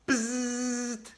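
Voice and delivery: nasal-free flat buzz imitating a quiz buzzer. A narrator's reading. Reader imitates the quiz buzzer, steady buzz. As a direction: make the buzzer noise with your lips.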